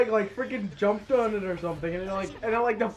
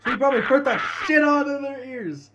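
ordinary talk, nothing marked

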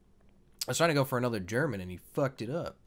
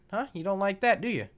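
normal talking voice

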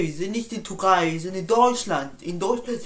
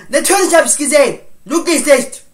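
higher, louder and fast; furious shout; 7 semitones higher, 14 dB louder than usual. Acted. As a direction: rage at him. Furious about girlfriend. (wütend und schnell geschrien)

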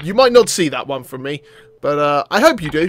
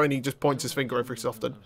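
ordinary delivery

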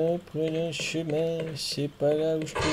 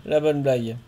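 normal talking voice

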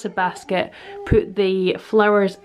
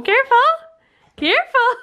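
high sing-song warning; 14 semitones higher, faster than usual. Real, not acted. Warning dancing baby.

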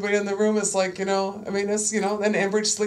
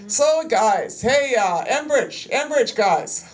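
higher and louder, a firm mom voice calling the room; big girl voice; 6 semitones higher, 4 dB louder, much slower than usual. Shown as a demonstration. Activist addressing pipeline company men, firm mom voice. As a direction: call out to the men like a no-nonsense mom. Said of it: "I use my big girl voice, you know, this one here, my mom voice".

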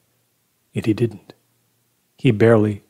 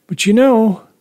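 higher, louder, through a pained smile; weird pained smile; 12 semitones higher, 6 dB louder than usual. A narrator's reading. Troubled man confiding in the priest, weird pained smile. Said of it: "he said with a weird, pained smile".

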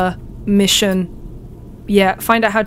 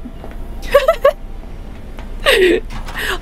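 shocked laughter; 13 semitones higher, 4 dB quieter than usual. Real, not acted. Laughing after the glitch.

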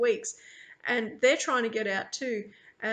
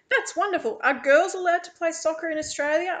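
high, loud eager gush; gush, then ask excitedly; delighted wonder; 7 semitones higher, 6 dB louder than usual. A narrator's reading. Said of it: "I gasp".

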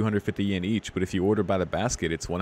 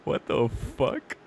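startled, stretched-out swearing; real startled shock; 6 semitones higher, slower than usual. Real, not acted. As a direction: react to a jump scare in disbelief. Reacts to a sudden jump scare.

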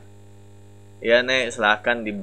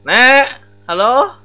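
raised call of a voice, higher; calling loudly to an unresponsive granny customer; 7 semitones higher, 7 dB louder, slower than usual. Real, not acted. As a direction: shout for the granny's attention.

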